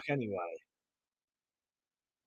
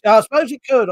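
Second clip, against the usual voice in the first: higher and louder, emphatic; 7 semitones higher, 9 dB louder, faster than usual. Real, not acted. Repeated phrase, first take.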